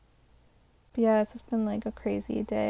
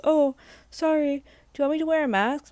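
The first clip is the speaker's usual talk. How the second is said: mock driver; 7 semitones higher, 6 dB louder than usual. Driver voiced in retold story.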